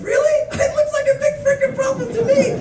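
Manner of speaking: very high, fast squeal; incredulous. Retold or imitated. Tourist doubts driver's easy turn, high-pitched and incredulous. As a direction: squeak it out in comic disbelief.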